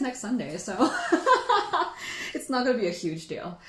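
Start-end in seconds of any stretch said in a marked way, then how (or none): 0.8-2.3 a teary, happy high laugh; high emotional laugh. Real, not acted. Host laughing to viewers, high and emotional. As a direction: giggle high with emotion, falling off.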